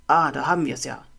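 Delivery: loud bully voice, triumphant at the find; gloating bully. A narrator's reading. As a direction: gloat loudly over what you found. Bully finds the victim's homework. (laut triumphierend, hämisch)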